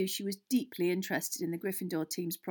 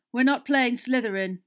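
loud, higher, angry announcement; 5 semitones higher, 10 dB louder than usual. A narrator's reading. Captain telling his team of unwelcome news, very angry. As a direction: break bad news angrily. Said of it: "looking very angry".

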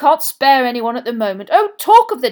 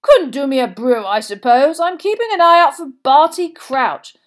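loud, cheerful and raised in pitch; 6 semitones higher, 13 dB louder than usual. A narrator's reading. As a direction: boom it out like a jolly official. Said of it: "Bagman turned most cheerfully back".

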